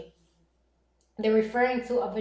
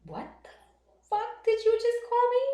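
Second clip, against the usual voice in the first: high-pitched mock outrage; indignant retold comeback; retold disbelief; 12 semitones higher than usual. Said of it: "And I said".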